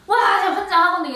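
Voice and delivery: fast, loud burst of exasperation; exasperated outburst. Real, not acted. Telling her viewers about the useless store, exasperated. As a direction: blurt it out, fed up and fast.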